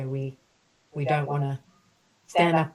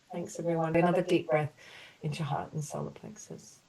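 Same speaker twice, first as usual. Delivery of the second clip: gentle, measured apology statements for the group; compassionate healing affirmations; 3 semitones higher, 3 dB louder than usual. Acted. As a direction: offer each sorry like a soothing balm. Leads group through healing apologies.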